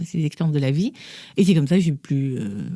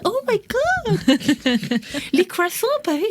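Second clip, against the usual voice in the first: much higher, thrilled squeal; hammed-up starstruck fan; gushing Americans; 13 semitones higher than usual. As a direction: tutors swooning over France, imitated in a very high gush. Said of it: "c'était toujours".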